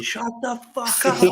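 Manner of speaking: high-pitched mock yell, no real volume; retold shout. Man on a balcony shouting down to a chanting crowd, retold as a high mock shout. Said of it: "shouting to everybody".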